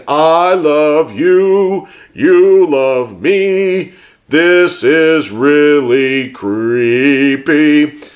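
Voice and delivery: very slow, sing-song puppet voice; eerie slow Barney impression; little Barney voice. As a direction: drag it out like a creepy kids' dinosaur. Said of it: "Is doing the little Barney like that".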